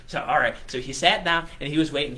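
plain reading voice

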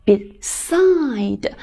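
"Beside" drawn out slowly, stressed on "side"; short "be", then a long falling "side" in "Beside"; stress on second syllable. Shown as a demonstration. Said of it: "side这个音就读得比较重".

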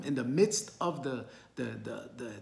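ordinary talk

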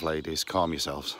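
normal talking voice

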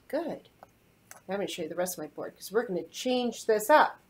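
ordinary talk, nothing marked

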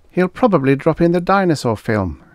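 high-voiced assistant. A narrator's reading. Assistant guesses for his boss, high-voiced. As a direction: pitch the assistant well up.

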